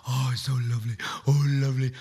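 mild imitation of a gushing waitress. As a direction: coo over the baby like a doting waitress. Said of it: "she just goes".

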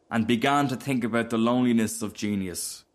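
plain reading voice

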